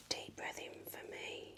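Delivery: normal talking voice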